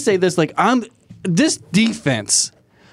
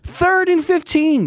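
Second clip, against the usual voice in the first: exasperated; 8 semitones higher, slower than usual. Real, not acted. Ranting about defense.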